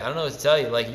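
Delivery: usual speaking voice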